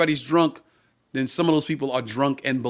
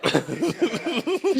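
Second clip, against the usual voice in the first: hearty laugh; 10 semitones higher than usual. Real, not acted. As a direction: laugh in disbelief. Laugh at memory.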